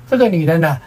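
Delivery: usual speaking voice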